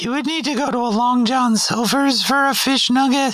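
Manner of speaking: put-on high, polite teen cashier; chirpy drive-thru kid behind the counter; fast food cashier voice. McDonald's cashier roleplay.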